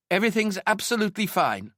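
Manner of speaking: high, bright forced reassurance; falsely cheerful. A narrator's reading. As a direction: lie brightly through the door. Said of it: "lied through the door".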